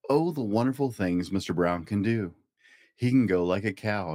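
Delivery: ordinary delivery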